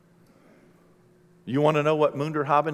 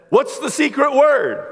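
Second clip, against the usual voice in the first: put-on higher, louder child's challenge; child's question; 8 semitones higher, 4 dB louder than usual. Child at the window demanding the secret word, higher and louder. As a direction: ask it up high like a wary kid. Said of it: "And my child said".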